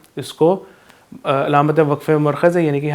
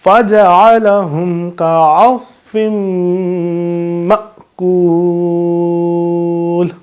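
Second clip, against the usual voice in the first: Quranic recitation; 3 semitones higher, 3 dB louder, much slower than usual. Shown as a demonstration. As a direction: recite it slowly with nasal ghunna. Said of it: "اس طریقے سے".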